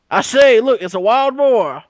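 put-on loud, high, excited old-timey exclaim; blustery hunter. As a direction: call out like a gentleman on safari. Roosevelt brain hunting the speaker.

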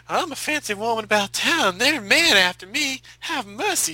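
high, drawling Southern belle; Southern belle accent. Retold or imitated. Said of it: "She's from, uh, Tennessee".

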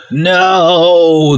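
high, loud shriek of loss; a wailing witch watching her necklace burn; witch's cry. A narrator's reading. Witch princess cries out as her necklace burns. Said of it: "the witchy princess cries".

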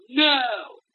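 sudden high yelled "No!"; retold scream. Man on a four-wheeler yelling at the lost drivers, high shout. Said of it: "Started screaming at it".

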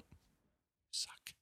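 tiny, almost inaudible voice; comically faint little utterance; barely audible. Pretend. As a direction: let it nearly vanish, as if only dogs could hear. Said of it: "Only dogs heard that".

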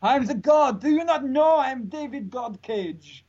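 high, slow, booming mock god voice; megalomaniac rant. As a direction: proclaim yourself a god, hammed-up. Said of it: "omnipotent, uh, deity almost".

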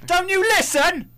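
high, loud, slow squeal of indignation; mock outrage. Host mock-scolding his co-host for not listening, loud and high. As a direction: shriek it in hammed-up disbelief.

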